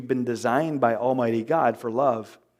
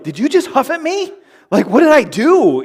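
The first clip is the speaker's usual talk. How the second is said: indignant spouse; 10 semitones higher, 7 dB louder than usual. Retold or imitated. Acted spouse quarrel.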